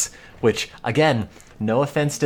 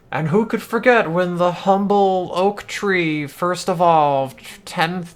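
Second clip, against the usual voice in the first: clickbait video narrator; 5 semitones higher than usual. Acted. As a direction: narrate like an over-the-top nature video. Parodies a dramatic plant-evolution video.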